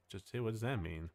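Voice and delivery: ordinary delivery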